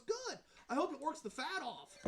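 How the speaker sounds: high, pointed grandma voice; imitated grandma. Grandma insults her working grandson, imitated high and pointed. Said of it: "She goes".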